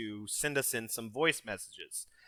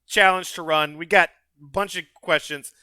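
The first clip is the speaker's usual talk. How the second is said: loud, insistent; 7 semitones higher, 10 dB louder than usual. Real, not acted. Pushing to move on.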